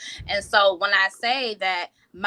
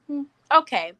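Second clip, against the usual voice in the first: skeptical husband; 2 semitones higher than usual. Retold or imitated. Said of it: "looked at me as in to say".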